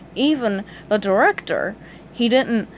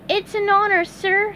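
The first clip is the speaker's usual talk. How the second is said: high, bright cartoon sponge voice; honored, eager to please; squeaky delighted fry cook; 10 semitones higher than usual. A narrator's reading.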